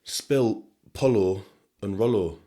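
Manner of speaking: "polo and rollo" drawn out slowly; slow spelling out. Shown as a demonstration. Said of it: "If you know, you know".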